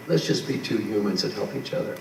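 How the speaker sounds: normal talking voice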